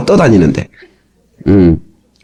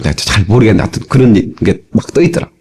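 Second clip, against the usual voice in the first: normal talking voice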